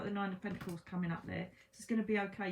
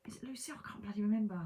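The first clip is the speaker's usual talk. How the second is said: whispered aside; faster than usual. Real, not acted. Muttered aside, forgetting.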